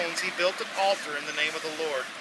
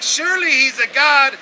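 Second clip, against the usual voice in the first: higher, louder mock concern; sarcastic taunt; 6 semitones higher, 7 dB louder than usual. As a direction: tease them about their sleeping god. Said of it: "He's like".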